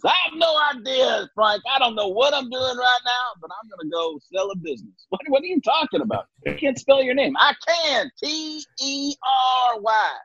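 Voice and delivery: a manic Southern Bradshaw, yelling the spelling; Terry Bradshaw impression. Bradshaw shouting a Southern answer back to Frank. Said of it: "when you do Bradshaw's voice".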